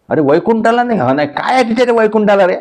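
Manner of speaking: high, quick baffled question; God can't understand the refusal; puzzled God. Acted. God asking Namdev, high and fast.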